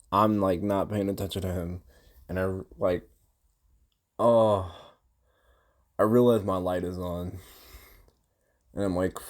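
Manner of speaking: ordinary delivery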